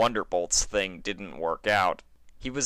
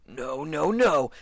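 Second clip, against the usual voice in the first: rising, louder panicked no's; panicked denial; 9 semitones higher, 5 dB louder, slower than usual. Acted. Stallion stuck in a window, panicking aloud. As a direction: panic as he realizes he's stuck.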